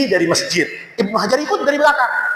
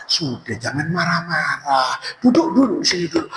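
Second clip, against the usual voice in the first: lower, softer calming voice; soothing seller; 8 semitones lower, 3 dB quieter than usual. Acted. Seller tells the imam to sit and calm down, softly. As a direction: hush him down patiently.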